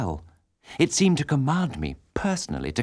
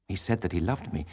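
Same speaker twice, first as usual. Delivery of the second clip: plain reading voice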